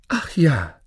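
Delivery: high, falling cry of agreement; fairy-tale princess jumping at the offer; eager princess. Acted. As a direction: agree at once, pitched high.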